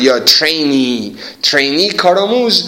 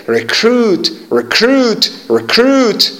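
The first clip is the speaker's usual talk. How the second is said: "Recruit" with a high, strong "cruit"; stress on second syllable; 6 semitones higher, slower than usual. Shown as a demonstration. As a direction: punch the "cruit" in "recruit", pitched up. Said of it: "استرس روی سیه".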